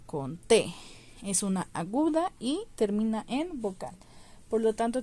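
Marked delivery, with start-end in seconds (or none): 0.1-0.7 conté with the final -TÉ punched; con-TÉ, weight on the final é; stress on final syllable. Shown as a demonstration. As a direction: hit the last syllable of conté. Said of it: "donde lleva el acento".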